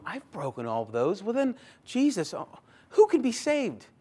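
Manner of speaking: hammed-up desperate cry; desperate and scared. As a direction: voice the crowd's panic, climbing. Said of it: "They're desperate. They're scared".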